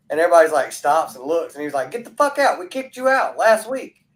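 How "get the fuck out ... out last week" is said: high-pitched New York pizzeria guy throwing someone out; New York accent. Retold or imitated. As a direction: imitate an irate New Yorker, pitch way up. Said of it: "they had the accent and everything".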